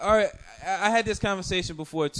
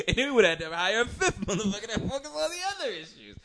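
fast, higher, words tumbling through laughter; laughing; 6 semitones higher, faster than usual. Real, not acted. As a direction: giggle your way through the joke. Joking about hiring a fifth one.

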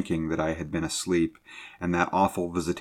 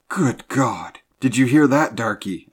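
high, loud shocked awe; startled, urgent question; awed tones; 6 semitones higher, 8 dB louder than usual. A narrator's reading. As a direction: cry out, aghast at the sound. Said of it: "asked the mate in awed tones".

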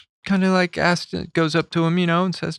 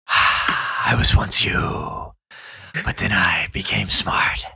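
slow, breathy, softer rasp; Jack Palance impression; 6 semitones lower, 4 dB quieter, much slower than usual. Teasing a friend in a slow whispery Palance voice. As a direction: sigh it out like a weary old gunslinger. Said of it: "keep doing it".

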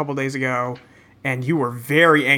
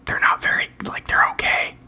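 whispering. Real, not acted. Keeps whispering the opinion.

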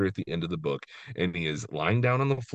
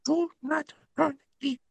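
high, pleading monster voice; Grover voice. Retold or imitated. Co-host reading Grover's plea on her favorite page, high and pleading. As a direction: beg the reader not to turn the page. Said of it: "your Grover voice".

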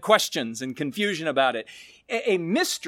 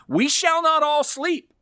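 emphatic quote; 9 semitones higher than usual. Shown as a demonstration. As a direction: proclaim it with rising wonder. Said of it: "here's the great mystery".

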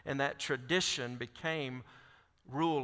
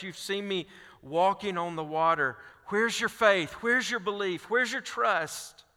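higher and louder, pressing harder each question; pleading challenge; 4 semitones higher, 4 dB louder than usual. Acted. Jesus presses the disciples about their faith, building to impassioned appeal. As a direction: drive the questions home, building to the last.

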